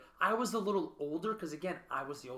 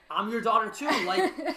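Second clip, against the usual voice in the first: louder, higher plea to be noticed; pleading for attention; 5 semitones higher, 9 dB louder than usual. Retold or imitated. Daughter to mother, raised pleading voice. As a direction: cry out to be seen by a busy parent.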